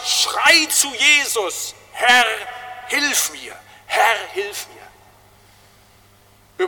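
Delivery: slow, crying shout of a drowning man; sobbing cry for help. Retold or imitated. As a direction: cry out as you sink. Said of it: "da gibt's nur eins". (schluchzender Hilfeschrei)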